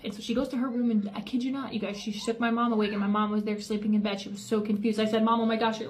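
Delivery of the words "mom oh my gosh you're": ordinary talk, nothing marked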